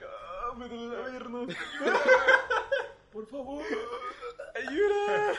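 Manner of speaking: high, slow wailing plea for help; Iván imagining himself gangrened, wailing for help; mock agony. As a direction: whimper and beg, hammed up. Iván imagined injured and pleading.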